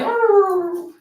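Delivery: high yelping dog howl. Retold or imitated. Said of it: "he just make this little howl".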